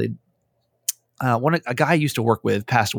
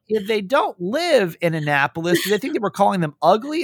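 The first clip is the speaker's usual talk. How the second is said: imitating Jess; 7 semitones higher than usual. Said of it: "She's like".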